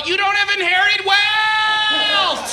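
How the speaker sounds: high, slow shouted line from a water slide; mock yell. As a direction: yell it out mid-splash, hammed up. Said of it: "just yell".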